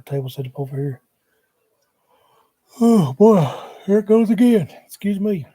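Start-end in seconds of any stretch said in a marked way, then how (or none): 2.8-5.4 words stretched through a big yawn; real yawn, louder and higher. Real, not acted. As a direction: talk through a loud yawn. Yawning fit mid-sentence.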